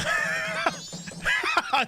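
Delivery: shrieking laugh, up high; high squealing laugh. Real, not acted. Laughs at own silly word.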